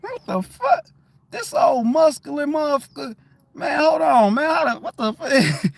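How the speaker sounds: high-pitched mock disbelief; hammed-up startled double take; imitated bewildered onlooker. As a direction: voice a baffled stranger, well above normal pitch.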